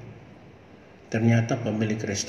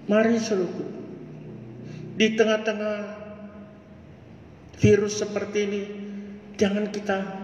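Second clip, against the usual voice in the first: raised, ringing pulpit voice; fervent preaching; 12 semitones higher than usual. Acted. Preacher urging his brothers and sisters, fervent preaching. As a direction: preach it out to the congregation with rising fire.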